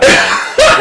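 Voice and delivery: a short airy laugh, high up; breathy laugh. Real, not acted. Friend laughing before the blended breakfast story.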